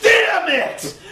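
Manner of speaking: very high, loud mock yell of disbelief; hammed-up commentator meltdown; losing it. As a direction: cry out in comic horror, drawing out the words. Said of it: "Cole lost his shit".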